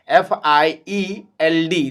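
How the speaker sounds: ordinary delivery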